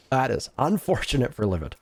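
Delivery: ordinary talk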